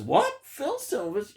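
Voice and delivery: a high squeak of mock disbelief on "What?"; mock surprise. Reviewer pretending to be a shocked viewer, high mock disbelief. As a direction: act amazed at the casting news.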